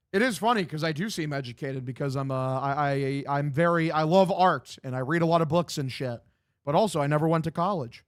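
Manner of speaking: ordinary talk, nothing marked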